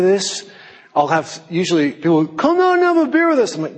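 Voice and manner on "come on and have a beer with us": high, pleading whoop; eager invitation. Retold or imitated. People asking him out afterwards, high eager mock coaxing. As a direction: imitate keen friends coaxing, pitched way up.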